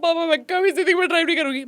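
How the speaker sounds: fast, high, loud mock-tearful vow; पापा से रोते हुए जल्दी-जल्दी, ऊँची आवाज़ में. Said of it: "मैंने कहा".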